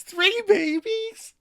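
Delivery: very high, slow, amazed squeal; Alice hearing the triplet news, thrilled squeal; buzzing. Retold or imitated. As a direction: stretch it out in delight. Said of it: "Alice buzzes".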